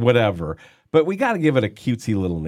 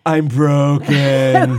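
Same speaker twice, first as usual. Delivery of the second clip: slow, whiny, laughing "I'm broken"; mock self-pity; 4 semitones higher, 6 dB louder, much slower than usual. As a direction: drag out a pitiful moan. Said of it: "You're mocking what you are".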